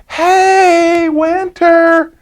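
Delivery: put-on high, bright call of a little girl; little girl waving her arms, calling to Winter. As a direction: call across the yard as the girl. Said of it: "And she's like".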